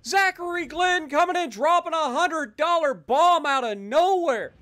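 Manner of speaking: loud hyped shoutout; high excited announcing. Real, not acted.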